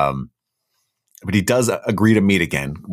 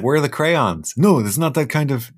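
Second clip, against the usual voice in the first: high playful voice; 8 semitones higher than usual. Pretend. Voicing the wife, then the husband.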